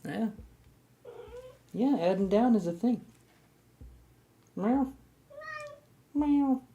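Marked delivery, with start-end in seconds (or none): 4.5-6.6 high playful meow; high-pitched mock meow; cat meow. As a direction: meow like a cat at your pet. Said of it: "meowing at the cat".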